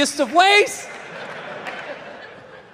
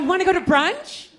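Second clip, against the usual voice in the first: put-on eager girlfriend; 7 semitones higher than usual. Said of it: "You ever get this one?".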